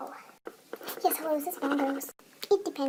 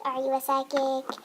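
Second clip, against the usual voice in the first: ordinary talk, nothing marked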